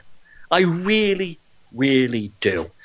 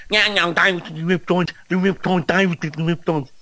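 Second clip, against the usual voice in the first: higher, silly parroting voice; mock believer voice; 6 semitones higher than usual. Mimicking awakened people going on about reptiles, silly high voice. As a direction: mimic a babbling conspiracy follower, pitched up. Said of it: "They were going".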